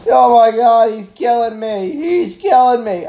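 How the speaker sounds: loud, high, slow mock scream of a dying man; panicked victim yelling. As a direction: ham up a victim being hacked at. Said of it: "all the guy's doing is".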